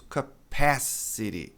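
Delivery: "capacity" stretched as kuh-PAAASS-uh-ree; slow, drawn-out "capacity" with the T flapped; long stressed vowel. Shown as a demonstration. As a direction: lean long on PASS in "capacity". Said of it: "Es acentuados, agudos, longos".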